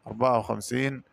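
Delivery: ordinary talk, nothing marked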